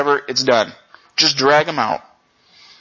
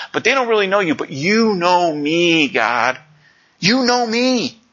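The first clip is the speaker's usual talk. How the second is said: earnest prayer; 2 semitones higher than usual. Acted. Voicing Jeremiah's prayer.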